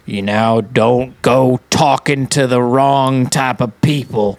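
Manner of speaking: put-on slow Old West drawl; extreme Old West voice. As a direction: draw out a cowboy warning in a Western accent. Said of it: "extreme voices of the West".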